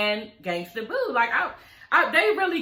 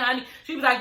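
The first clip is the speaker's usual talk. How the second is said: ordinary talk, nothing marked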